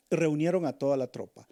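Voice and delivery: usual speaking voice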